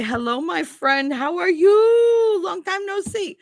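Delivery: high, drawn-out greeting; imitating local guys. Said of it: "the guys by me all say".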